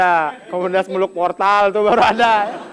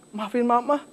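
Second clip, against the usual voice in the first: lightly voiced mom; 3 semitones higher, 3 dB quieter than usual. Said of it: "Mah, eh, masak nggak hari ini?".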